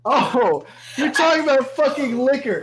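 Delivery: loud, high-pitched exasperation; exasperated outburst. Real, not acted. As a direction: raise your voice at a distracting player. Game master exasperated by distracting player.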